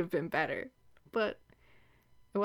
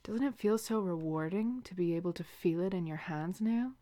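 ordinary talk, nothing marked

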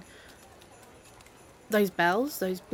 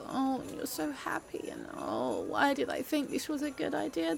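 quiet despair; 2 semitones higher, 9 dB quieter than usual. Acted. Despairing at happy friend.

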